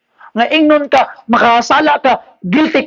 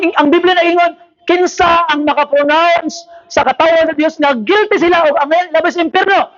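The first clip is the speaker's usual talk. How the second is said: usual speaking voice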